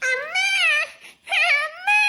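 high, rising wail; high tearful call; crying for mother. Acted. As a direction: bawl upward into a sob. Bawling girl calls for mother.

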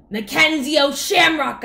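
loud, high, maddened yell; furious leprechaun yell. A narrator's reading. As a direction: yell your name in exasperation. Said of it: "I yelled".

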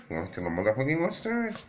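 high quoted catchphrase. Retold or imitated. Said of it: "we're gonna go".

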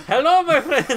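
high, loud, badly faked Romanian accent; hammed-up Romanian accent, cracking into laughter. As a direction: shout a greeting in a silly Romanian accent. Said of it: "do a Romanian accent".

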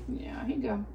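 whispered, no voice at all; whisper to cat. Real, not acted. Whispering to her cat while handing it food. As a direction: breathe it softly to the cat.

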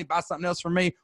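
ordinary talk, nothing marked

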